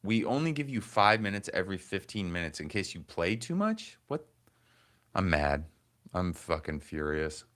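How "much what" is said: incredulous. Real, not acted. Reading play limit reason.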